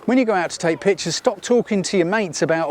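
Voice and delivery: usual speaking voice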